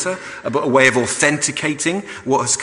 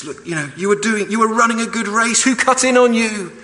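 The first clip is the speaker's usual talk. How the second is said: higher, louder frustrated apostle; frustrated Paul; 10 semitones higher, 3 dB louder than usual. Retold or imitated. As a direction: plead with them, rising frustration. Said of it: "more than a little bit frustrated".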